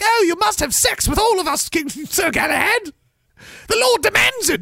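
very high falsetto pleading; hammed-up maiden squeal of insistence; castle maidens. Acting castle women in film scene.

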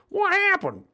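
very high squeaky "what happened"; mock deaf yelp. Repeating the ringing-ears joke, high mock yelp. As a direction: pipe it up high as if deafened.

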